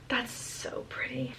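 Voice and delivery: breathless whisper after a gasp; awed whisper. Real, not acted. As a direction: whisper it in awe of the book. Gasping at the book.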